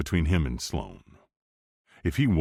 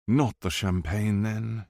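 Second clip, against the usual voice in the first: put-on posh English accent; 4 semitones higher, slower than usual. A narrator's reading. Edward mocking bodyguard, posh character.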